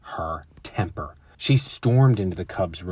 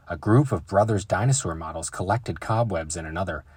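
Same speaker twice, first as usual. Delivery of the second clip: usual speaking voice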